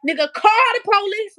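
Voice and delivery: loud, high-pitched re-enacted dare; defiant retort. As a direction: dare them angrily to do it. Re-enacts defiant answer to threat.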